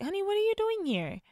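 high, quick surprised mother; a startled mom asking fast. Retold or imitated. Mom greeting her son at the door, high and fast.

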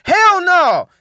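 loud, high, firm rejection; emphatic refusal. Acted. Preacher showing how you gotta tell him, loud emphatic refusal. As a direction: belt out a flat-out refusal. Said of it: "Sometimes you gotta tell him".

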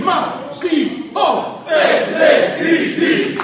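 stadium announcer calling the scorer's name; rising high, drawn-out goal call; breathless italian goal commentary. Shown as a demonstration. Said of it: "E quando faceva gol lui dicevi qualcosa tu?".

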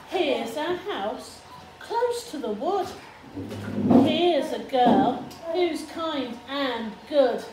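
put-on reading to a toddler. Reads story aloud to child.

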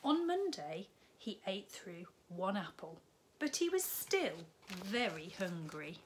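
lively storybook read-aloud; bright children's story narration. A narrator's reading. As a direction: tell it like a bedtime story to little ones. Reads picture book to children.

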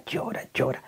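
whispered. Retold or imitated. Recalling her nonstop crying.

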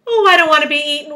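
loud, high, fast hammed-up wail. A narrator's reading. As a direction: wail like a terrified storybook earthworm. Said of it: "wailed the earthworm".

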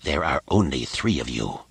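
low, quiet, menacing rasp; a cold dry rasp, used to obedience; dry rasping Sith lord. Acted. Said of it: "his voice a dry rasp".

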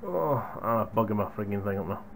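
ordinary talk

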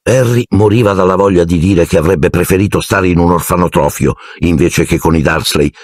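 normal talking voice

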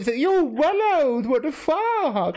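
high incredulous outburst; mock shock. Caller learning partner is forty-six, mock shock.

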